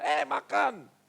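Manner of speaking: loud, high call to eat; imitated parent's yell. Parent yelling at a child to eat, mock shouting. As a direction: holler like a parent summoning kids to eat. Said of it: "Teriak-teriak orang tua".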